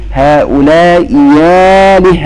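هؤلاء آلهة chanted slowly, second hamza turned to ya; slow Quranic reading in the Warsh style. Shown as a demonstration. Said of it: "ورش هنا يقرأ فيقول".

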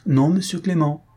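tease, yet refuse with firm resolve; playful tone with determination underneath; joking but irrevocable. A narrator's reading. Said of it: "d'un ton qui, pour être celui de la plaisanterie".

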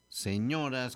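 señoras with a leaned-on ÑO; se-ÑO-ras, accent overdone; exaggerated stress. Shown as a demonstration. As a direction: punch the O of señoras. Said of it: "con acento en la O".

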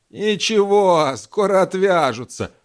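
put-on slow, unhurried old driver; drawn-out, calm old mechanic. Migalych voiced by narrator.

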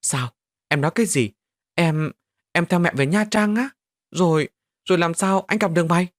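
stunned and panicking as his friend leaves; trembling voice. Acted. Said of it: "run giọng hỏi". (giọng run run, hốt hoảng, cao hơn)

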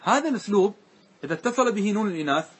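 normal talking voice

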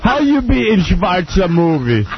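slow, laughing mock German; German accent. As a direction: drag it out in a heavy German accent.

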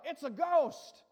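retold yell. Preacher quotes the terrified disciples, retold yell. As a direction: call it out as a retold cry. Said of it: "They yelled, what?".

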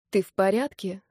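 ordinary delivery

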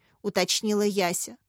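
ordinary delivery, nothing marked

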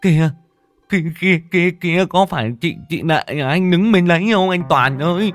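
high, loud panicked stammer; stammering terror. Acted. Terrified friend stammering to brother about ghost. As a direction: stutter it out in terror. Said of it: "miệng lắp bắp".

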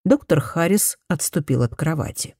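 usual speaking voice

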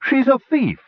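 high-pitched narrated protest. A narrator's reading. Herder objecting to sparing the captive, protesting. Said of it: "Ostermar protested".

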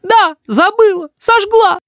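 very high, furious admission; defiant cry. A narrator's reading. Mother answers through the door, crying out. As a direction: throw it back high and defiant. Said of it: "кричит мама в приоткрытую дверь".

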